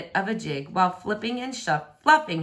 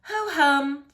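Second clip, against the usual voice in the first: bored yawn; 8 semitones higher than usual. Acted. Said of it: "Franny yawned".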